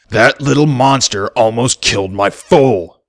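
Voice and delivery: furious father snarling at the colt; snarled. Acted. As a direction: snarl it through bared teeth. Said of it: "Filthy Rich snarled".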